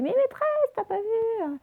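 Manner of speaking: put-on very high, squealing child voice without real volume; mock pupils' yell. Teacher imitates pupils yelling at her about missed words, mock high-pitched yell. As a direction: imitate kids crying out to teacher, held back. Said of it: "en train de crier, de hurler".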